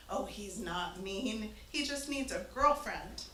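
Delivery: lightly voiced thick Slavic accent, slow; a hint of a Slavic farmer, slow and easy. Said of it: "in his very thick Slavic accent".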